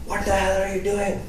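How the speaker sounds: put-on high-pitched accented dad voice; imitating an angry father. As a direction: scold as an outraged immigrant dad. Acted father catching son gaming.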